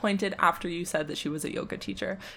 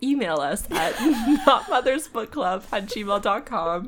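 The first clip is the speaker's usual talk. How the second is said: laughing; 3 semitones higher, 4 dB louder than usual. Real, not acted. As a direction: plug delivered through laughter. Cracking up during mock plug.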